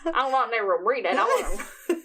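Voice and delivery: comic weird voice, cracking into laughter; goofy voice on the edge of laughing. Shown as a demonstration. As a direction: rush it in a silly put-on voice, giggling. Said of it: "Do it with your weird voice".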